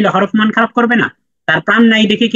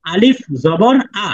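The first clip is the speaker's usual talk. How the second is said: slow model recitation; slower than usual. Shown as a demonstration. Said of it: "বলেন আমার সাথে".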